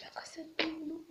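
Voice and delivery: tearful. Real, not acted. Still crying after the prayer.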